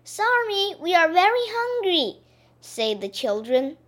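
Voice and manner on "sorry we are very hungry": high, pleading little voices; child voice. Acted. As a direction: beg like frightened kids. Children answer the witch.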